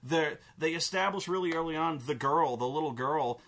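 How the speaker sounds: ordinary talk, nothing marked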